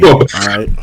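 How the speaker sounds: usual speaking voice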